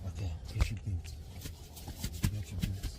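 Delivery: loud, urgent and scared; loud warning. Real, not acted. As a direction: call out to scare it off. Scared camper shouts a warning.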